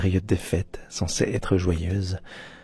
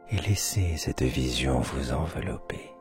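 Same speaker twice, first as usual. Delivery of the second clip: guided meditation; 3 semitones lower, 4 dB quieter than usual. Acted. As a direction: guide them into sleep, half whispering.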